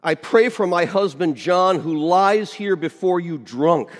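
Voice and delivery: loud, high imitation of a praying wife; hammed-up loud plea to God; fervent prayer. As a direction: cry out a mock-fervent prayer, stretching the words. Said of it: "folds her hands fervently in prayer".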